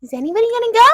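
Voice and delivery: high mock-teacher question. Student mimics the teacher calling for a volunteer, high. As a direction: lightly imitate a prodding teacher. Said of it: "she'd be like".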